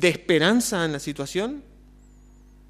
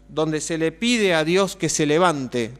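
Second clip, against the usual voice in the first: usual speaking voice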